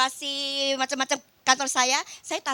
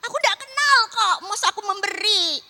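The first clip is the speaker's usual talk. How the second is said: higher and louder, insisting she owes nothing; loud protest; 7 semitones higher, 8 dB louder than usual. Retold or imitated. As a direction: argue back hard at God. Self arguing with God, retold. (protes keras dengan suara tinggi)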